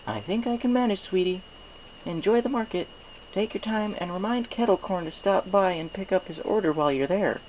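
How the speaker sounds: high, sweet motherly pony voice; sweet mayor voice. Acted. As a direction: lift it up high and kindly for the baker's wife. Mister Cake replies to his wife.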